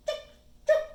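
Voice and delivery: two short, sharp clucks; quick clipped bird call; thrasher call. A narrator's reading. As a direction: chirp twice like a thrasher. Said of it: "he congratulates himself two times".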